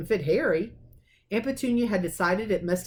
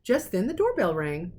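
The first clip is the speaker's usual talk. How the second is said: plain reading voice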